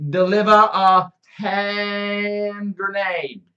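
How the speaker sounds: high, slow mock-Australian oracle; Australian accent. Spirit foretelling a listener's death, drawn-out Australian accent. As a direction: chant it out in hammed-up Australian. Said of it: "I'll do the accent".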